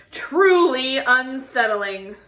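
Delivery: expressive picture-book read-aloud; reading to children. Acted. Teacher reading a tortoise story to children, expressive. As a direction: tell the story warmly to a class.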